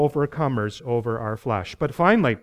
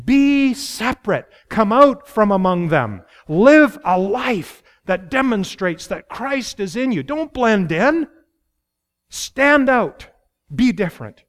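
higher, louder, slow commanding proclamation; God's command; 6 semitones higher, 4 dB louder than usual. Retold or imitated. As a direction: proclaim it like a divine order. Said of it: "This is why God tells us".

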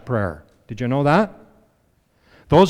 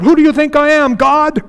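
much higher and louder indignant shout; Jacob's angry retort; 12 semitones higher, 7 dB louder than usual. Retold or imitated. Jacob answering Rachel, loud high indignant retort. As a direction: fling it back at her, indignant. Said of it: "Jacob got mad and said".

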